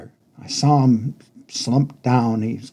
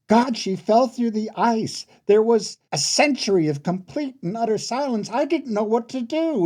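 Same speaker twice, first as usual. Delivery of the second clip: distressed; 10 semitones higher than usual. A narrator's reading. Narrator reliving the accident, distressed.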